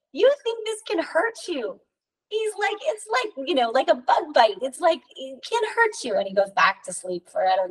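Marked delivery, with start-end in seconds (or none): 0.1-6.2 high mock-wise sage voice; Yogananda laughing it off. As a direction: tease them like an old sage waking up.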